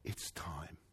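barely audible, low murmur; very calm. Retold or imitated. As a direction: imitate a hushed, calm announcement. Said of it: "Very calmly".

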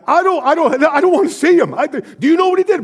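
high, loud protest; upset mother. Retold or imitated. Mother refusing to see her estranged father, upset and loud. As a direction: refuse him, hurt and angry. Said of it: "She's like".

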